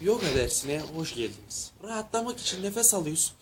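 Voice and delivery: soft, calming class voice; yoga instructor. Acted. Instructor welcoming people to yoga class, soft calming voice. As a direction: guide the breathing softly.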